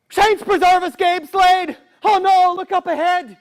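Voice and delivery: high frightened engineer warning; alarmed engineer. Acted. Engineer sees robbers, alarmed.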